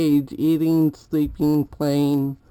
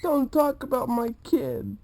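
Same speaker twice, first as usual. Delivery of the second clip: higher voice for the bird; voicing the bird; 8 semitones higher than usual. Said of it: "she's probably like".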